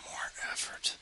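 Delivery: whispered, sighing. Real, not acted. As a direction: breathe it out as a sigh. Sighed aside.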